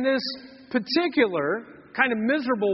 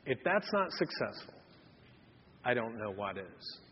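quiet content husband; 8 semitones lower, 9 dB quieter than usual. Retold or imitated. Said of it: "Charles turns to Caroline and says".

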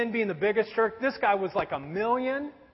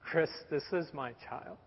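low, hushed voice of the Spirit; gentle inner prompting; 6 semitones lower, 10 dB quieter than usual. Retold or imitated. The Spirit correcting an angry man, soft and low. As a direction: say it softly and low, as a quiet correction. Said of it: "a prompting by the Spirit".

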